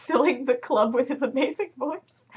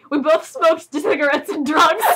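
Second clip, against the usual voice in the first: higher, laughing through the line; 4 dB louder than usual. Real, not acted. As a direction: barely hold back laughter. Reader laughing at text.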